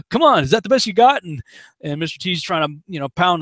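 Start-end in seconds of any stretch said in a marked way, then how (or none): 0.1-1.2 fast, high and loud mock taunt; Rocky taunting. Rocky goads Clubber Lang, fast mock jeer. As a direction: goad him, fists up. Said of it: "Rocky's taunting".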